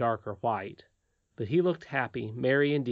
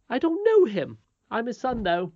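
haughty nurse; 10 semitones higher, 6 dB louder than usual. Acted. Nurse then boy.

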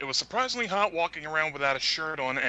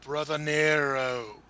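wrestling catchphrase; 2 semitones higher, much slower than usual. Retold or imitated. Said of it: "a guy in the other room go".

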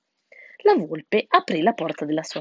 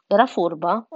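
ordinary delivery

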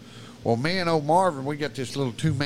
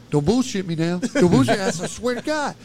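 loud, raised-pitch mock worry; scared insistence; 7 semitones higher, 5 dB louder than usual. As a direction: imitate a spooked friend demanding truth. Scared friend begs for truth.

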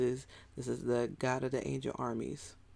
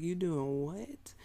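slow, drawn-out disbelief, pitched a little up; incredulous God; 3 semitones higher, much slower than usual. Retold or imitated. Preacher voicing God, incredulous at David's enemies. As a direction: play God hearing the news, stretching every word. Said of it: "God comes at it like".

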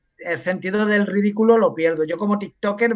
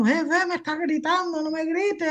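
high-pitched mock complaint, not shouted; imitando queja de esposa; 9 semitones higher, 3 dB quieter than usual. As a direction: imitate an upset wife in a much higher voice. Esposa se queja en riña contada. (queja aguda imitando a su esposa)

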